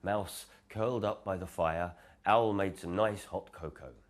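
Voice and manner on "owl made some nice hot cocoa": ordinary delivery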